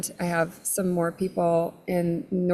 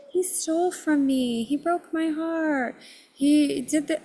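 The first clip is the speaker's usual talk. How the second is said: high-pitched imitation of wronged victims; wounded accusation; 8 semitones higher, slower than usual. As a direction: lament like one voice from an accusing crowd. Voicing the wronged crowd's complaints.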